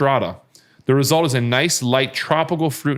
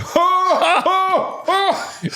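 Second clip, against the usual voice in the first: high fake laugh; much slower than usual. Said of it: "all you hear Mon be like".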